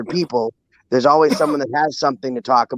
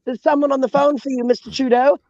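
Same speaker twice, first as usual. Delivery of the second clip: high-pitched announcing assistant; 10 semitones higher than usual. Acted. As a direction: announce the call like a butler. Servant announcing a phone call.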